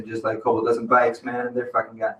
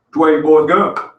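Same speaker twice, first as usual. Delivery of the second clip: gruff cop; 3 semitones higher, 7 dB louder than usual. Retold or imitated. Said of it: "He was like".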